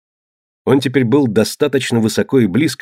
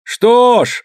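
much higher, loud captain; ship captain voice; 12 semitones higher, 3 dB louder than usual. A narrator's reading. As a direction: call back as the rival captain. Other captain answering.

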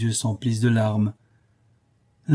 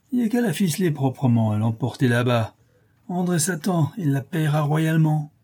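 hoarse whisky voice; 6 semitones higher than usual. A narrator's reading. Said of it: "d'une voix enrouée, atrocement huilée au whisky".

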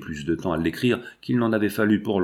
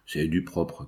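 lower, softer sarcastic aside; 6 semitones lower, 5 dB quieter, slower than usual. A narrator's reading. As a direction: drop it with understated sarcasm. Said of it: "continua-t-il d'un ton sarcastique". (d'un ton sarcastique)

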